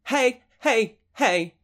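loud repeated calls; shouting. A narrator's reading. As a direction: keep shouting for attention. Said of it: "I repeat".

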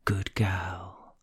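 whispered praise, close to the ear; soft whisper. Shown as a demonstration. Partner holding the speaker tight, soft whisper in the ear. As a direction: whisper it low and very softly. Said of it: "sometimes you whisper it softly in my ear".

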